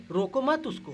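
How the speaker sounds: रोको मत उसको said high and loud, मत hit hard; stress on मत. Shown as a demonstration. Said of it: "तो कह रहे". (मत पर ज़ोर)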